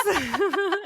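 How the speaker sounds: high squealing laugh; high burst of laughter. Real, not acted. Laughing at the tanning story, high squeal.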